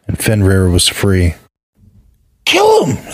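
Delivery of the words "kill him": put-on very high child's scream of kill him; a furious little boy shrieking; shrill childish outburst. A narrator's reading. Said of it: "screamed Vali".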